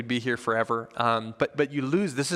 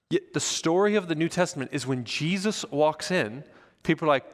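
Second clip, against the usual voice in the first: ordinary talk, nothing marked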